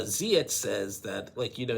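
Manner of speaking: ordinary talk, nothing marked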